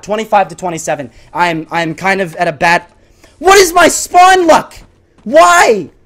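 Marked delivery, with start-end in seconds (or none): 3.4-5.9 high, loud, drawn-out complaint; exasperated yell. Real, not acted. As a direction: wail in frustration at bad luck. Frustrated at spawning in game.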